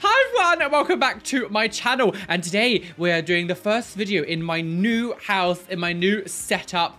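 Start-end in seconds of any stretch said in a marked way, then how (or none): 0.0-2.1 much higher, louder chirpy intro; mock youtuber intro. Parodying a bubbly vlogger welcoming viewers, high and chirpy. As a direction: squeak out the greeting like a parody vlogger.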